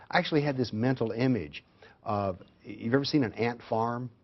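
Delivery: normal talking voice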